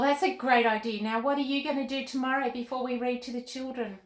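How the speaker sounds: ordinary delivery, nothing marked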